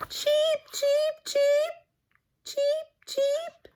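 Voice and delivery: very high chirpy cheeps; baby birds cheeping; baby chicks. Acted. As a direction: peep like tiny hatchlings.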